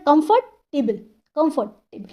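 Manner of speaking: Comfortable split with a pause in the middle; two-part sounding out of comfortable; broken into syllables. Shown as a demonstration. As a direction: break comfortable into two beats. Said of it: "जैसे बोलने में हम तोड़ रहे हैं".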